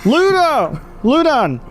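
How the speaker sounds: high yell from inside a house; high-pitched indignant call; calling back. Acted. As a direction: holler your name back through the door. Man in house answers shouted question.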